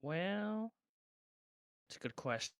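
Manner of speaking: usual speaking voice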